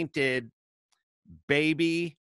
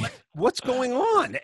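1 bewildered; 10 semitones higher, slower than usual. Retold or imitated. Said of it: "And I'm like".

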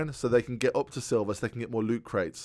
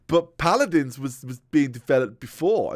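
higher, louder, slow whiny know-it-all; mocking chat commenter; 4 semitones higher, 9 dB louder than usual. Co-host mocks a chat commenter in a slow whiny voice. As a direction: mimic a smug chat nitpicker, drawn out. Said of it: "someone in chat that goes".

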